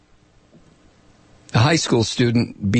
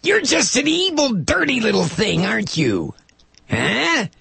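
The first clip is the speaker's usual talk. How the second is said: high sneering mock politician; mocking Palumbo; 5 semitones higher than usual. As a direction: sneer it like a bigoted lawmaker. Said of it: "Peter Palumbo referred to Jessica Alquist".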